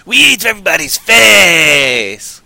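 loud, stretched-out zombie battle cry; mock menacing shout. Zombie host boasting about eating everybody, mock menacing shout. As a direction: holler it high and draw out the end.

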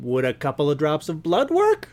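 normal talking voice

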